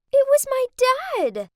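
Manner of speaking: high, loud shocked cry. A narrator's reading. Narrator spotting her dad as valet, shocked and loud. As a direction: gasp it out in disbelief.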